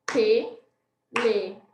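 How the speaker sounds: te-le-fo-ne, cada sílaba separada e lenta; syllable-clapped. Shown as a demonstration. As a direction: bata palma em cada sílaba de "Telefone". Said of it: "Vamos ver quantas palmas a gente vai bater". (palavra dita sílaba por sílaba, bem devagar)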